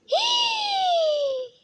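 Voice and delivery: high shocked gasp-shriek; a sudden shriek of horror at the mess. Acted. Girl shrieks seeing messy room.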